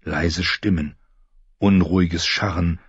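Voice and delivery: usual speaking voice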